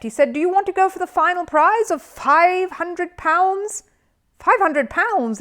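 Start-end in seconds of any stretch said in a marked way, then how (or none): 0.3-3.8 high, slow, showy game-show host; quiz host. A narrator's reading. Quiz host teasing Paddington with the big prize. 4.4-5.4 slow, high, breathless awe; awed. A narrator's reading. Judy awed by the prize money. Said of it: "in a tone of awe".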